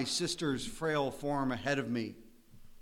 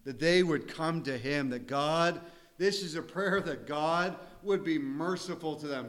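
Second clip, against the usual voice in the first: usual speaking voice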